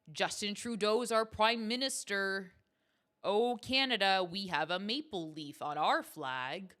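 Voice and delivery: put-on proudly overdone Canadian accent; hammed-up Canadian accent. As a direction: stretch the vowels like a cartoon Canadian. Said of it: "what are other things Canadians say?".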